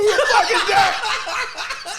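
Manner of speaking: high, drawn-out startled cry; mock startled yell. Kuwabara hearing a ghost whisper, hammed-up alarm. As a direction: jump with a high mock yelp.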